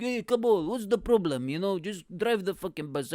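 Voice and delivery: acted exasperated call from the back; impatient Bulgarian passenger. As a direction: heckle the driver impatiently. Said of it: "If this was in Bulgaria".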